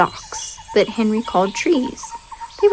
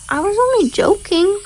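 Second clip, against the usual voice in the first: higher, friendly call from the hippo; lightly voiced hippo calling back; cheerful call across the river; 11 semitones higher than usual. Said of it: "called the big tan animal".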